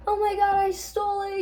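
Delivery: frantic panic. Retold or imitated. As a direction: blurt it out in a frantic, high panic. June running frantic up the steps.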